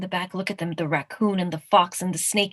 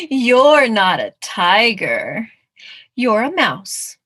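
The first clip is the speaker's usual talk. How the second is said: loud, drawn-out storybook retort; emphatic contradiction; 2 semitones higher, 8 dB louder, much slower than usual. Acted. Tiger setting the little mouse straight, loud and emphatic. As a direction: stretch it out and make it land.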